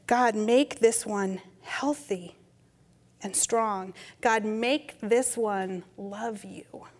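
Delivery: very slow blessing sinking to a whisper; whispered prayer. Retold or imitated. Grandmother praying over baby in the tub, whispered. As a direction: bless the child, voice dropping to a whisper.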